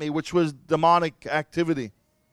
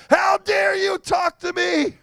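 slow, much higher furious yell; angry shout; 13 semitones higher, slower than usual. Retold or imitated. Man on his bed shakes his fist at God, shouting in fury. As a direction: rage at heaven, spitting each word. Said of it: "I was so angry".

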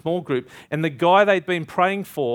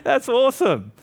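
laughing amazement, jumping higher; imitating laughing pastor; 9 semitones higher than usual. Pastor reacting to his would-be killer's jammed gun, laughing. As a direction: act out the pastor cracking up in delight.

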